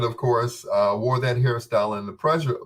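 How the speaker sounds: ordinary talk, nothing marked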